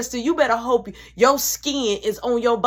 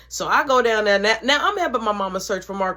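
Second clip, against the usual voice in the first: normal talking voice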